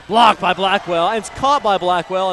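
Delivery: loud burst of play-by-play; excited block call. Real, not acted. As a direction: hit the first word hard, riding the action. Calling a blocked shot.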